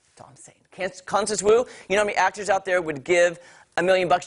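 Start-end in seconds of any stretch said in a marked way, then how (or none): 0.1-0.6 "That's all I'm saying" breathed with no voice; whispered aside. Real, not acted. Aside muttered off-mic.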